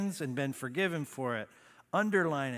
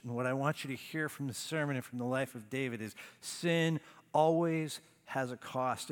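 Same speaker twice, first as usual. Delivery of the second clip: ordinary talk, nothing marked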